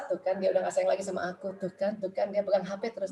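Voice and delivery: low, quiet sulky muttering; brooding inner voice. Acted. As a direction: mutter suspicions to yourself. Voices a suspicious wife's brooding thoughts. (menggerutu pelan dalam hati)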